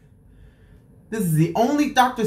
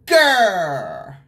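mock growl. Worm got mad.

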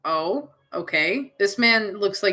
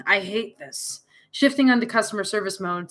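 ordinary delivery

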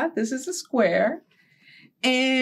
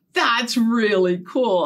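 ordinary delivery

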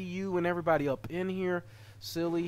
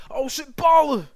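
loud, high mock exclamation; hammed-up raised-voice quote; imitated alarm; 9 semitones higher, 11 dB louder than usual. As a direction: imitate gangsters spotting a target. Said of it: "they just said".

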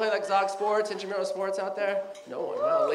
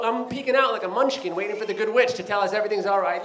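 ordinary delivery

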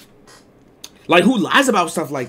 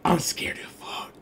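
slow, scared whisper; 3 semitones higher, 7 dB quieter, slower than usual. Real, not acted. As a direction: whisper it like you're afraid. Nervous during driving test.

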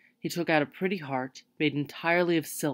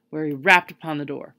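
usual speaking voice

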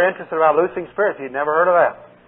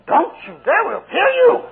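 retold demon scream. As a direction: screech the threat at him. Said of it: "He screamed at me over the phone".

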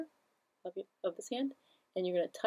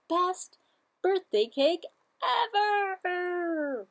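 excited drawn-out; 11 semitones higher, 5 dB louder, much slower than usual. A narrator's reading. Excited drawn-out story climax.

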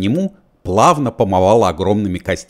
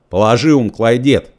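loud, higher insistent demand; 5 semitones higher, 5 dB louder than usual. A narrator's reading. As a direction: demand it back loudly. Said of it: "потребовал я". (громко и требовательно)

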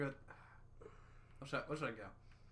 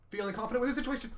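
panicked; 10 semitones higher, 7 dB louder, faster than usual. Real, not acted. Under fire in game.

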